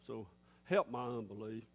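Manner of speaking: low, subdued doubting mumble; half-hearted, low-pitched admission of doubt; voice of unbelief. Acted. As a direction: doubt it softly, quickly, low in the voice. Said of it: "That's what unbelief is".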